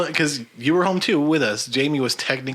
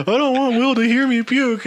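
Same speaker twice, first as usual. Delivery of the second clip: much higher imitated wail; mock drunken yelling; 10 semitones higher, 3 dB louder than usual. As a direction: holler it like a friend bent over the toilet. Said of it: "he was yelling it before".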